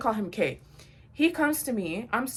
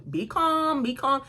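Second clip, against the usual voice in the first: higher-pitched, stretched urging; acted calming plea; 5 semitones higher, slower than usual. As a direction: voice a friend urging restraint, pitched up. Voicing friend's look telling her calm down.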